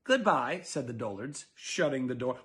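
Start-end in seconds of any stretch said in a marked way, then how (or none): 0.0-0.6 mild curt goodbye. Acted. Dullards shutting the door.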